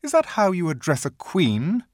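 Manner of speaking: put-on a queen putting a boy in his place; stern queen. A narrator's reading. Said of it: "looking sterner than ever".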